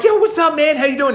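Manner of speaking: high, excited mock greeting; upbeat greeting. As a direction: greet an old friend with big energy. Voicing his own upbeat greeting.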